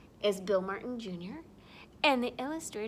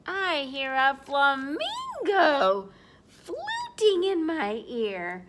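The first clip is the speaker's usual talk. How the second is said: higher, slow, stretched-out words; animated storytelling; 3 semitones higher, 4 dB louder, slower than usual. Pretend. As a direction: draw out the animal's sound word. Animal line read to children.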